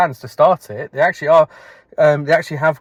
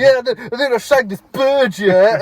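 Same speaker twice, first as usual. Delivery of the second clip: higher-pitched, boastful bullshitter voice; Jay from The Inbetweeners; 8 semitones higher, 3 dB louder than usual. Acted. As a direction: brag like a teenage tall-tale teller. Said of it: "he's like Jay from In Between".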